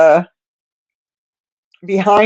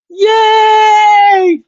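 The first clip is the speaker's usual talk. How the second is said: much higher, louder happy cheer; excited cheer; 5 dB louder than usual. Real, not acted. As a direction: celebrate like a little win. Cheers about lunches made.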